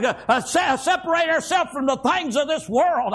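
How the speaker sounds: ordinary talk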